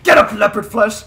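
loud gloating call; taunting shout. Pretend. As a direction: shout down at a rival. Taunting downed opponent.

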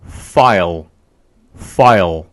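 "file" with airy F, long I, final L, pitch dropping low; English file pronunciation. Shown as a demonstration. Teacher summing up for learners, "file" carefully pronounced with English F. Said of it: "이렇게 발음이 되는 거예요".

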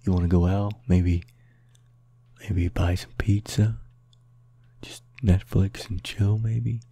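low, hesitant pick-up lines; mock flirting. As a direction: hit on someone, slowing and softening. Mock flirting with the exit sign.

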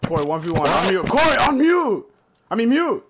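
loud call climbing high; urgent shouting. Real, not acted. Player yelling at Corey to unmute, urgent shouting. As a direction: yell to get his attention. Calling urgently to friend in game.

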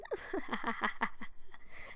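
soft wicked chuckle; evil chuckle. Acted. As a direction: chuckle like a schemer savouring a plan. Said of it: "That was a frickin' evil chuckle".

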